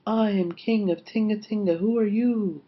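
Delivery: high, ringing echo voice; big voice. A narrator's reading. Echo answers Lion in the great cave, big voice. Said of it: "answered a big voice".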